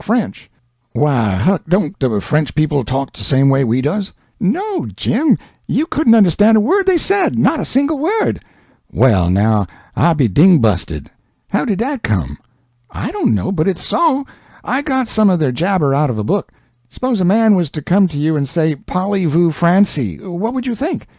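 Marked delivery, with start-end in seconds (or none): 4.4-8.4 put-on higher boyish Huck voice, eager; Huck, higher, setting Jim straight; young Huck explaining. Huck tells Jim about French, eager boyish voice. 12.9-21.1 put-on higher boyish Huck voice, eager; Huck, higher, setting Jim straight; young Huck explaining. Huck tells Jim about French, eager boyish voice.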